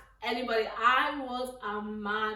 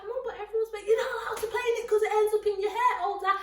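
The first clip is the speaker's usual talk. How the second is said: put-on whiny kid; 8 semitones higher, faster than usual.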